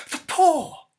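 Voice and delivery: sudden high, loud shriek; wild cry. Acted. Wife wakes her husband with a wild, high cry. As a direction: burst out with a desperate cry. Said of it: "she cried wildly".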